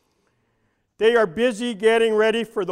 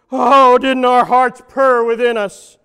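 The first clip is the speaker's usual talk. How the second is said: slow, loud, swooning gush; mock swoon; 4 semitones higher, 11 dB louder than usual. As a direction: gush like a dreamy crowd.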